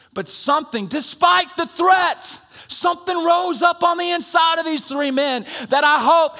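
high, loud shouted preaching; full-force preaching. Real, not acted. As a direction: lift the church with a shout.

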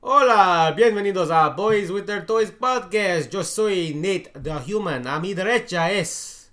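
boisterous Spanish-language host intro; Spanish show announcer. Acted. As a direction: announce it big and high, in Spanish.

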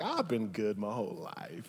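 low, soft self-satisfied aside; mock smugness. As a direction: play the smug Pharisee under the breath. Acting the self-satisfied Pharisees.

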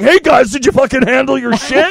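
bursting out to the cops with a razor; mock tough guy. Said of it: "being like".

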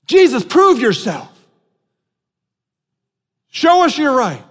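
yelling crowd. Retold or imitated. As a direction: call it out like the crowd taunting him. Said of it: "The crowds yelled".

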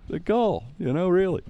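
high, loud, laughing speech; cracking up. Real, not acted. Storyteller agreeing it's crazy, still cracking up. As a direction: squeeze the words out through real laughter. Talking through his laughter.

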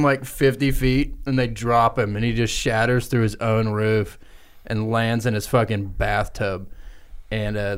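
ordinary talk

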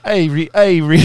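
laughing, loud mock Australian accent; a botched Australian accent, laughing through it; bad Australian accent. Teasing his cohost's failed Australian accent, loud and laughing. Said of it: "You're like".

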